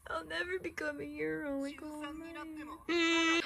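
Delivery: high, slow fake sob through the words; hammed-up crying voice; mock crying. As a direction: whimper it like a heartbroken kid. Mock-crying character line.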